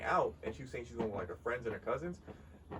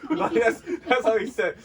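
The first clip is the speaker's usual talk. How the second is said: laughing; 12 semitones higher, 13 dB louder than usual. Real, not acted. Laughing at brother's blunt words.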